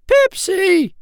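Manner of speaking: very high, loud calling; eager little bird call; crying out. A narrator's reading. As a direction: call your brother's name, long and piping. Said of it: "he cried".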